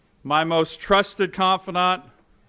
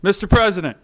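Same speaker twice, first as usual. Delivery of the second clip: loud, raised formal address; higher, projected mock floor address; senator addressing the chair; 4 semitones higher, 6 dB louder, faster than usual. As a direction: call out to the chair, imitating a senator. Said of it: "She says".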